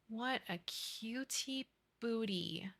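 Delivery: slow, breathy whisper over a cute pet; whispered cooing. Real, not acted. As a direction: whisper it like you can't take the cuteness.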